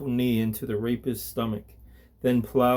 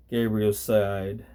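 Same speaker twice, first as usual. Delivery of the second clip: ordinary delivery, nothing marked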